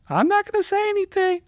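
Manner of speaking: high sing-song "I'm not gonna say anything"; coy paralegal. Retold or imitated. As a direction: tease that she knows something.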